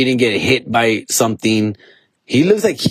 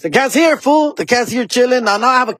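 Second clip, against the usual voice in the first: excited; 10 semitones higher than usual. Real, not acted. Excited about the cat.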